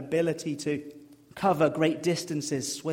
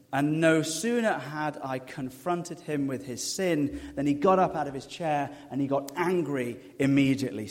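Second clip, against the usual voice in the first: ordinary talk, nothing marked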